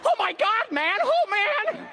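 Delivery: mock Tucker. Imitating Tucker's reaction.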